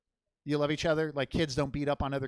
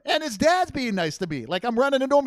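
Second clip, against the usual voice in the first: usual speaking voice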